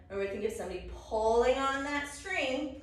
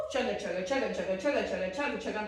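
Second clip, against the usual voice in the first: steady rhythmic chugga; chugging train noise; 3 semitones lower, much faster than usual. Pretend. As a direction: chant it like turning train wheels.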